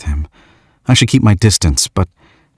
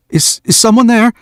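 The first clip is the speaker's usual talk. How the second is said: put-on higher, startled young man's call; nervous young man calling. Young stargazer calls out to hidden watcher, startled. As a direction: call out uneasily toward the dark woods. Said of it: "he calls".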